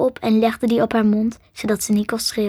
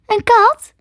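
high, louder rising question; incredulous girl; 11 semitones higher, 6 dB louder than usual. A narrator's reading. Girl queries the creature's claim, high and incredulous. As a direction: ask it high, in disbelief.

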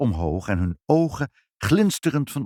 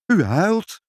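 high, louder startled cry; shocked mother crying out in alarm; 10 semitones higher, 5 dB louder than usual. A narrator's reading. Mother startled that the guest is crying. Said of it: "riep moeder verschrikt".

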